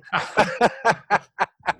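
high burst of laughter; laughing. Real, not acted. Laughs at own joke.

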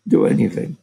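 low mock grumble, dismissive; grumbling. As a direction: grumble it back like an annoyed surgeon. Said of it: "he grumbled something like".

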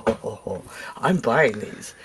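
scheming glee. Retold or imitated. Gleeful find in the store.

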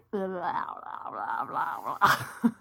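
giggling fit breaking up the read; laughing helplessly at the mistake; burst of laughter. Real, not acted. Outtake, breaks up mid-read.

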